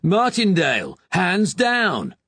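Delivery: impatient shout. Acted. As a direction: bark it across the courtyard. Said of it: "shouted out again".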